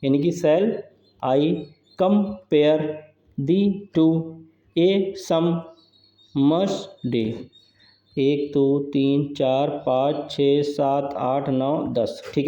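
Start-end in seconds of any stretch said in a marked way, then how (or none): 0.4-7.2 "shall, I, com-pare, the, two, a, sum, must, be" in slow separate beats; very slow, with a gap after each syllable or two. Shown as a demonstration. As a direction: break the line apart for counting syllables. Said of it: "अब count करिए यहाँ पे".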